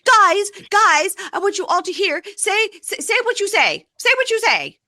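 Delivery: very high mock-hysterical shout; shrieky retold yelling. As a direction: act out a hysterical wife rallying the crowd. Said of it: "hysterically yelling at Josh".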